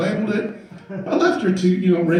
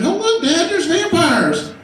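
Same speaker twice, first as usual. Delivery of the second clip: put-on high excited little boy; kid brother voice; 8 semitones higher, slower than usual. Little brother in the car, excited kid voice. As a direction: squeal it like a small kid pointing. Son voiced in retold story.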